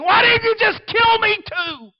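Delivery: high, loud anguished scream. Retold or imitated. Grieving wife screaming at God at night, anguished. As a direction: cry out in grief at God. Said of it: "I can hear her screaming".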